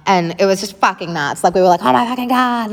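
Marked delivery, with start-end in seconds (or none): 1.8-2.7 high-pitched mock panic, not truly screamed; retold panicked screaming. Retelling kids' terror as dad drives at harbour, mock panic. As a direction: imitate kids panicking, at normal volume. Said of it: "everyone was screaming".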